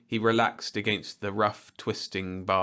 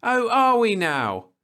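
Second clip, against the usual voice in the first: much higher, louder, slow indignant jab; angry; 9 dB louder than usual. A narrator's reading. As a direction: rise up in anger. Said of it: "she said angrily".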